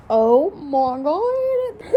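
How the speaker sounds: loud, slow stunned cry; loud disbelieving oh my god; shocked outburst. Real, not acted. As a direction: blurt it out in shock.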